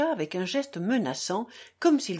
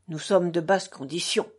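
plain reading voice

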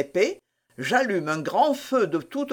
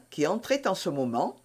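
ordinary delivery

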